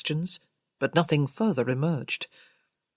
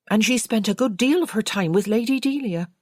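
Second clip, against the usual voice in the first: a touch higher and louder, chatty servant; lightly voiced maid; 5 semitones higher, 5 dB louder than usual. A narrator's reading. Maid telling the investigators about a former colleague, lightly voiced. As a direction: recall it like a gossiping maid.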